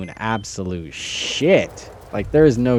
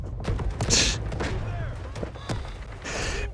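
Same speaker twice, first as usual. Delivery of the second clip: exasperated high laugh; 8 dB quieter than usual. Real, not acted. Laughs after ranting about teammates.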